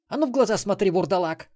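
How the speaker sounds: high, loud demand; angry girl. A narrator's reading. Melissa orders Xen to look her in the eyes, loud. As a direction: glare and order him, loud.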